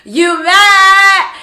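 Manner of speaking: high, very loud drawn-out jeer; gleeful sing-song taunting yell; laughing taunt. Retold or imitated. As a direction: holler it mockingly through laughter. Acting out laughing taunt at woman.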